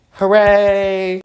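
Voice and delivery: high, sarcastic hooray; mock cheer. Mock-celebrating drinking one cup of water, high cheer. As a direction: cheer it like a fake party.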